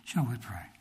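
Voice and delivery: ordinary delivery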